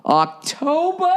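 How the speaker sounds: high, drawn-out question; mock surprise. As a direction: stretch it out in fake amazement.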